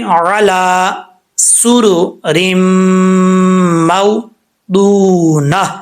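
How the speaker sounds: عَلَى سُرُرٍ مَوْضُونَةٍ drawn out, measured; slow recitation. Shown as a demonstration. Teacher shows how to read the verse slowly. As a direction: recite each word slowly and clearly. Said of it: "کیسے پڑھیں گے؟".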